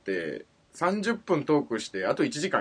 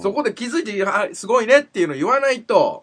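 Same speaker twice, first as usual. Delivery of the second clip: louder, higher exasperated scolding; overheated complaint; 4 semitones higher, 6 dB louder than usual. Real, not acted. Host scolds his partner for not praising his observation, exasperated. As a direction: demand the praise you were owed. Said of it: "怒りすぎじゃない？".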